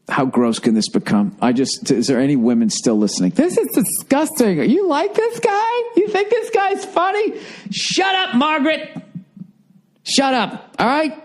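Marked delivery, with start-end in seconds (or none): 3.3-7.4 whiny woman voice. Acted. Imitating offended woman listener. 7.7-11.2 mock scolding. Telling the imagined woman off.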